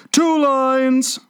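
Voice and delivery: high-pitched turtle calling out; eager, high creature voice; excited cry. A narrator's reading. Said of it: "cried the Mock Turtle".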